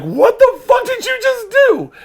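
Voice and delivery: very high, loud, drawn-out shriek of disbelief; shocked yell. Retold or imitated. Friend to drunk seatmate after the shush, shocked yell. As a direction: holler it slowly, utterly appalled.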